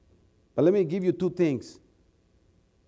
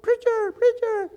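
much higher, calling out from the phone; a small voice calling from the dropped phone; caller's voice on phone; slower than usual. Retold or imitated. Young man on the phone calling for the preacher, high-pitched. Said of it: "you know, his voice".

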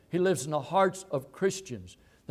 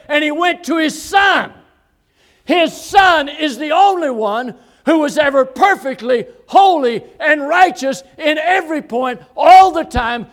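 loud, high hollering sermon, building to shouts; preaching at full force; 9 semitones higher, 14 dB louder than usual. Acted. Preacher proclaiming God's son to his congregation, hollering. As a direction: thunder it out to the congregation.

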